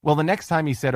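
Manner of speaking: ordinary delivery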